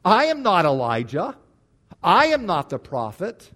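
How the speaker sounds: "I am not" said with insistent emphasis; emphatic I. Shown as a demonstration. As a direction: stamp down on "I" each time. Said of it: "the "I" in these statements seem to be emphatic".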